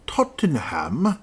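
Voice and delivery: slow, drawn-out questioning rise on "Tottenham"; "Tottenham" stretched out slowly, rising as if doubting the name; puzzled query. Shown as a demonstration. Host doubting the stadium name on screen, slow puzzled rise.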